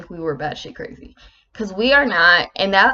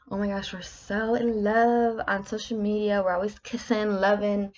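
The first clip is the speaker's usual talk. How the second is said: mock lovey-dovey; 2 semitones higher, 5 dB quieter than usual. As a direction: coo like a show-off couple in love. Mocking lovey social media couples.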